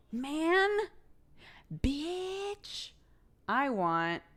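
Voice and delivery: high, drawn-out rising exclamation; exclaimed longing. Retold or imitated. Streamer retelling urge to go snowboarding, longing exclamation. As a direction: let each word climb high with yearning. Retold longing reaction to video.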